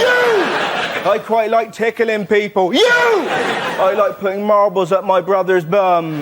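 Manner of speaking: put-on slow, giggly child voice; a touch of a laughing child; kids' answers. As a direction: answer like a cheeky kid. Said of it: "child answers".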